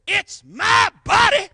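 hammed-up high shouting slogans; mock protest shout. Mock modern woman protest.

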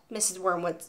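ordinary delivery, nothing marked

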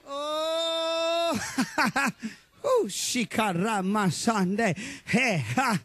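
slow, low tongues babble; speaking in tongues. Retold or imitated. Preacher imitating teens speaking in tongues, slow and low. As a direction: let the syllables roll out ecstatic.

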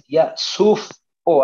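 "suf o" split, F closing the first part; mock mispronunciation. Shown as a demonstration. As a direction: break "suf o" in two, slowly. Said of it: "así dirías".

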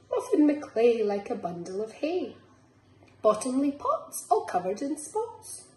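lilting picture-book reading; reading to children. Acted. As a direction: bounce the rhymes along for little ones. Reads picture book aloud.

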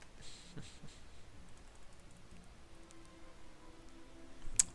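soft breathy snicker; quiet scheming laugh. Real, not acted. A player snickering before attacking. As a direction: chuckle to yourself over the plan.

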